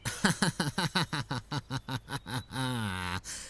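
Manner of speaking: long, mocking evil laughter; scornful laughter. Townsman laughs at Lot's call to fear God, mocking laughter. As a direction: laugh off the warning with contempt.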